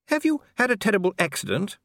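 a concerned lady asking gently; Lady Sybil. A narrator's reading. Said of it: "said Lady Sybil".

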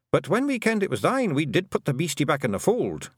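high, pleading little man's voice; apologetic tiny blue man. A narrator's reading. Tiny thief confessing to his mistress, apologetic.

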